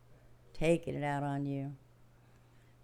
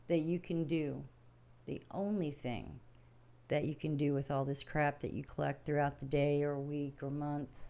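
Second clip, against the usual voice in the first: usual speaking voice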